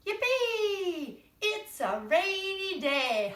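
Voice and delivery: high, loud yippee, then slow; excited worm. Acted. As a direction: cheer like a happy little worm. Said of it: "And they say".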